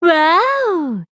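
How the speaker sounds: long, drawn-out wow; delighted wow. Acted. Spotting signs of a jaguar, delighted wow. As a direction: stretch out a wowed exclamation. Exclaims on sensing something.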